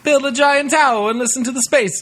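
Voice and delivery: high silly mock voice; squeaky voice mocking the brothers; high mock Italian. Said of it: "who said".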